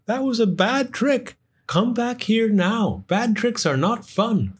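high, firm storybook scolding. Acted. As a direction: tell him off gently. Sam scolding Gus.

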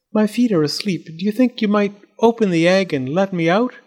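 high-pitched, pleading little doll; doll's voice. A narrator's reading. Doll asks to be let out of the egg, high voice. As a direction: ask politely in a high doll voice.

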